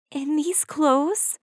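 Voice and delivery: slow, high, soft sad question. A narrator's reading. Cinderella looks at her ragged dress, asking slowly and sadly. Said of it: "said Cinderella sadly".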